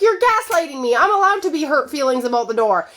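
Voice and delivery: high, loud mock accusation; accusing wife. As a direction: fling the accusation at him. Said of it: "And you're like".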